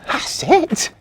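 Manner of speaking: high, loud eureka cry; sudden bright idea, burst out; excited cry. Acted. Marzi perks up with an idea, high excited cry. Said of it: "she cried".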